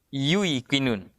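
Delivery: yuikinun drawn out slowly, yu then i; original form. Shown as a demonstration. Teacher slowly sounds out yuikinun for students. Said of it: "Itu aslinya".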